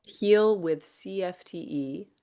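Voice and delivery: slow and carefully spelled out; over enunciating. Shown as a demonstration. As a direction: spell out each letter slowly. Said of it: "say it one more time slowly".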